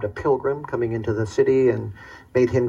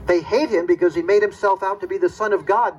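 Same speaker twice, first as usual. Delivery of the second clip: ordinary talk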